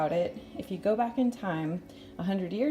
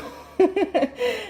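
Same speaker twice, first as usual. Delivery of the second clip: laugh; 14 semitones higher, 6 dB louder than usual. Real, not acted. Laughs after reciting saying.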